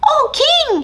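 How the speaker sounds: tiny mouse hailing; mouse calling out. Acted. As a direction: cry out to the king. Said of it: "he cried".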